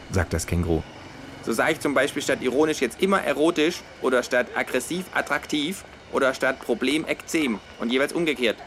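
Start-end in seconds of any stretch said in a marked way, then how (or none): 1.4-8.6 lightly higher, smug kangaroo. Acted. Kangaroo explaining its word game to flatmate, lightly voiced. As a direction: explain it like a pleased know-it-all.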